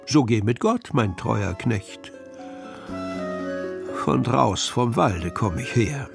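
ordinary delivery, nothing marked

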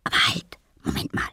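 whispered sharp stop; startled whisper. A narrator's reading. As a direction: hiss it out in surprise. Paul peeks in, startled. (erschrocken geflüstert)

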